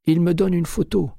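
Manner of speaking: high, choked pleading voice; frightened clerk. A narrator's reading. Terrified clerk under McCaleb's arm, high and pleading. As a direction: beg to be let go.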